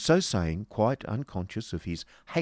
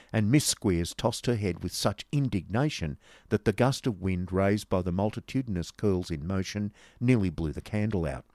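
plain reading voice

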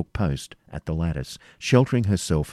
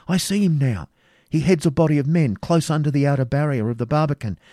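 raised pitch, urgent eyewitness account; agitated Rebecca, excited battle report; 9 semitones higher, 4 dB louder than usual. A narrator's reading. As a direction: report the fight breathlessly caught up in it.

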